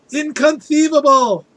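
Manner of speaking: high loud indignant cry; Vizzini impression. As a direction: shriek it in outrage. Quoting Princess Bride line.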